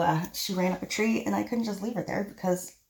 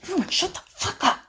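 panicked shout; 10 semitones higher, 5 dB louder than usual. Acted. Hiding from shooter, yelling orders.

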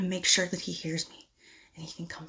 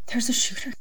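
frightened whisper; 4 semitones higher, 4 dB louder than usual. Acted. Realizes a shooter is near.

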